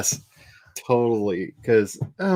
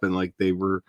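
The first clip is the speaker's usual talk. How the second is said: ordinary delivery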